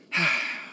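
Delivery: a short tired sigh out through the nose; soft sigh. Real, not acted. Wistful sigh about too little free time. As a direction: let out a small weary breath. Sighs before planning to see show.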